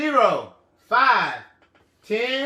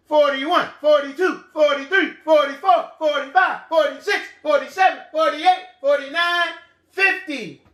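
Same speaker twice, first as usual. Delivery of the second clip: rapid-fire counting, higher pitched; really fast; 4 semitones higher, 4 dB louder, faster than usual. Shown as a demonstration. Teacher speeding children through the forties, really fast. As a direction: rattle the numbers off briskly. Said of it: "Really fast".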